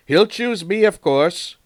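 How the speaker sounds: high, loud smug boast; boastful. A narrator's reading. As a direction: brag as if it's obvious. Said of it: "boasted James the Red Engine".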